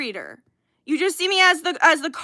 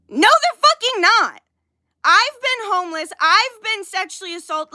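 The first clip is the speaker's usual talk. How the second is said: real outburst, raised pitch and hard attack; angry yelling; 4 semitones higher, 3 dB louder than usual. Real, not acted. Streamer rebutting critics from own hard experience, angry yelling. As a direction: shut the claim down, incensed. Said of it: "I am sorry I am yelling".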